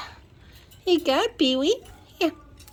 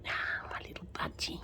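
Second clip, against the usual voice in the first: whispering; 18 dB quieter than usual. Real, not acted.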